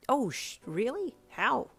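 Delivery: loud, high disbelief; amazed. A narrator's reading. Guy hearing a newcomer beat a boss, amazed. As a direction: fire off astonished questions.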